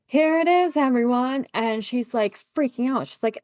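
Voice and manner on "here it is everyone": showy host unveiling a prize, much higher; mock grand announcement. Husband parading the baby before the crowd, mock grand announcement. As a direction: present it to the crowd like a proud showman.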